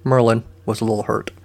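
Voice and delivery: plain reading voice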